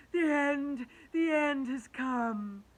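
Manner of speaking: high, loud, slow lament; despairing wail. A narrator's reading. Centipede facing the crash, despairing wail. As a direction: wail in doom.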